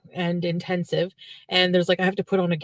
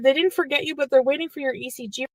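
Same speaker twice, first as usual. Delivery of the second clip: higher-pitched mock French Canadian; French Canadian lady, pitched up; French Canadian accent; 5 semitones higher than usual. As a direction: imitate the volunteer's French Canadian English. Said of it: "supposed to be a French accent".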